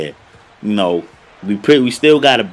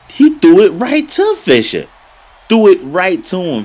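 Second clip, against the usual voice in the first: much higher and louder, cracking with outrage; outraged yell; 10 semitones higher, 6 dB louder than usual. Real, not acted. Gamer yelling at the screen over a terrible pass, outraged. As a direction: shout it like you can't believe the pass.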